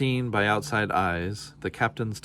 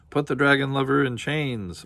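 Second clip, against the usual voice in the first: a raised guard's command; hint of a commanding guard; guard's order; 5 semitones higher, 4 dB louder than usual. A narrator's reading. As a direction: bark the order lightly, like a guard. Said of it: "ordered one guard".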